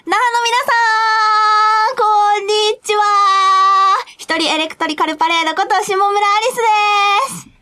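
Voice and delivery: high, bright cartoon girl greeting; chirpy anime heroine intro. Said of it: "アニメ声っぽくしてはるんですけど".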